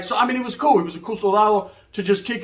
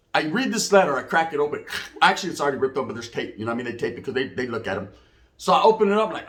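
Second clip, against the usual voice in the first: ordinary talk, nothing marked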